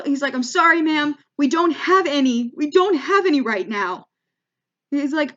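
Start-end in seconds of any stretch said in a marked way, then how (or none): 0.3-4.1 mock-flustered shop clerk, louder and higher; retold freak-out, raised voice; flustered apology. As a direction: apologize in a hurried fluster, imitating a panicked clerk. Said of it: "the guy, he freaks out".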